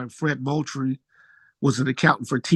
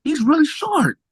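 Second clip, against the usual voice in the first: surprised; 10 semitones higher, 3 dB louder than usual. Retold or imitated. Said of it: "It's like, "Damn".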